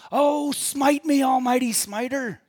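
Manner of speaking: loud, high, drawn-out yell; yelling at heaven. Retold or imitated. Preacher imitates Bruce yelling at God in heaven, loud defiant yell. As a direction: shout it up at God, imitating a desperate man. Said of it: "he yells at God in heaven".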